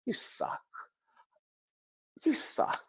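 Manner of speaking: hushed whisper to God; muttered whisper, barely voiced; whispered complaint. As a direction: breathe it out under your breath. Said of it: "I said".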